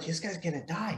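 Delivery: hushed, soft imitation of a worried master; imitated whisper. Worried grandmaster whispering to the instructor at a testing. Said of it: "He's, like, whispering in my ear".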